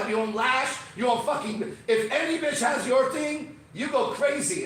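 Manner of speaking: high-pitched, heated tirade; worked-up mock rant. Telling girls off over copied style, worked-up rant.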